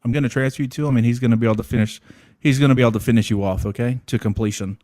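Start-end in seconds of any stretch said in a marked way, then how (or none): none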